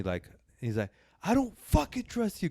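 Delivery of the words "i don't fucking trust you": imitating a heated outburst, much higher. Retelling [name] snapping at the podcaster, mock heated. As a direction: fling it at him in mock fury. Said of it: "you could see [name] get mad".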